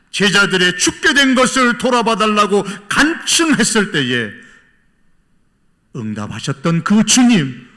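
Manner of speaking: loud, high pulpit delivery; full-force preaching. Real, not acted. Preacher challenging the congregation about asking Jesus, forceful preaching. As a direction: press the congregation with ringing rhetorical questions.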